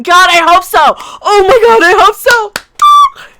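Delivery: loud, high cry of hope, building and drawn out; fervent hoping. Real, not acted. Fervent mock hope, shouted.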